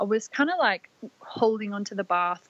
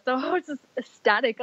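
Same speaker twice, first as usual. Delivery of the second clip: ordinary talk, nothing marked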